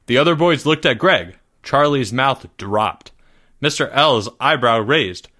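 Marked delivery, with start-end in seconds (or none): none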